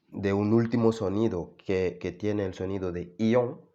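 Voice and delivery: ordinary delivery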